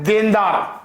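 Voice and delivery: ordinary delivery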